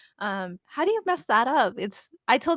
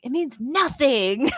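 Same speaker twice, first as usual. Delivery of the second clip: excited son; 3 semitones higher, 5 dB louder, slower than usual. Retold or imitated. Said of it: "He's all excited".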